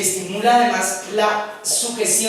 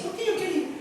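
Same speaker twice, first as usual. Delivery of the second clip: niño lloriqueando; 9 semitones higher, 8 dB quieter, faster than usual. Acted. Niño llorando responde.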